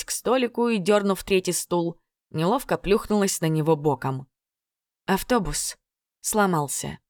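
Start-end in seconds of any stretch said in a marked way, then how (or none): 5.0-7.0 apologetic. A narrator's reading. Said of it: "пояснила она, словно оправдываясь".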